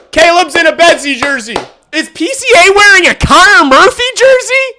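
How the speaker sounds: high, loud laughing disbelief; excited. Real, not acted. Streamer asking chat about the jerseys, thrilled and laughing. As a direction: shout it like you can't believe it.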